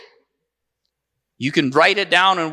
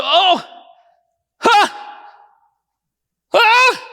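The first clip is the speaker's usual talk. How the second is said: rising higher breathless gasps of shock; gasping; 11 semitones higher, much slower than usual. Retold or imitated. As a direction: gasp in awe as the leg moves. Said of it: "he gasped".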